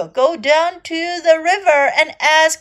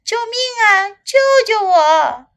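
high, louder cry for rescue; shouting for help; 8 semitones higher, 5 dB louder than usual. A narrator's reading. Little elephant trapped by crocodile, shouting for help. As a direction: call out urgently, pitch well up. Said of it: "小象大喊起来".

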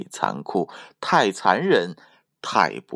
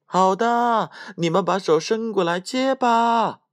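very high, ringing character shout; full-throated calling; 13 semitones higher than usual. A narrator's reading. Tom yelling back to the thieves, full-throated calling. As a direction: call out at the top of a small character's voice. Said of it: "敞开了嗓门叫道".

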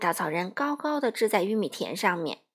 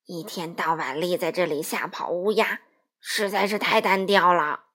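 lightly voiced scarecrow, lower; a weary straw man grumbling mildly; bored scarecrow; 5 semitones lower, 4 dB louder than usual. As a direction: scarecrow telling Dorothy its dull job, mildly weary.

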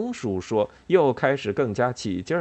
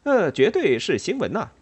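high, excited mouse insisting; urgent, raised fieldmouse voice; breathless cry; 6 semitones higher, 4 dB louder than usual. A narrator's reading. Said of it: "威力上气不接下气地叫道".

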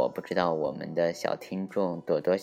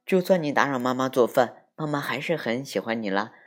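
put-on lively, higher storytelling voice for kids; children's story narration; 6 semitones higher, 4 dB louder than usual. A narrator's reading. Host reading a picture book to small listeners, animated storytelling. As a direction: read it like a bedtime tale full of voices.

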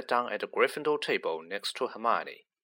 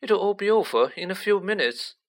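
urging; 8 semitones higher, 4 dB louder than usual. A narrator's reading. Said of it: "Neville urged".

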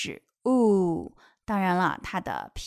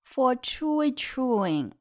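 ordinary delivery

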